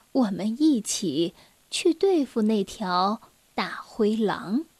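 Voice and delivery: soft, low, hushed bedtime voice; soothing mother. Acted. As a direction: gently hush a child back to sleep. Mother soothes child to sleep. (妈妈低声轻柔地哄睡)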